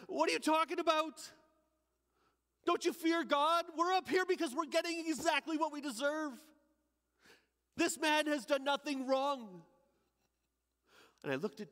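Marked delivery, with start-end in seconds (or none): 0.1-9.7 shouting back. Acted. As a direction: retort sharply, calling across. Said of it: "I shouted out".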